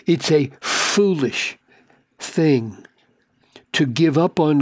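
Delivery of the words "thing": ordinary delivery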